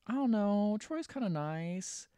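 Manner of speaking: high, drawn-out mock hesitation; hesitant defense. Friend on the phone defending a man, hesitant. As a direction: imitate a friend hedging in a high voice.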